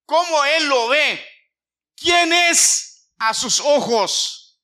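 loud, slow, emphatic sermon climax; forceful preaching. Acted. (predicación con fuerza y lentitud)

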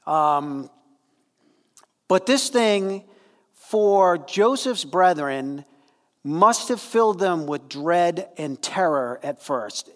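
normal talking voice

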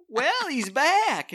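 sing-song high, slow "well, he's back" with a laugh; drawn-out mocking welcome, high and slow; high sarcastic greeting. Poll worker greets returning voter, high and sarcastic.

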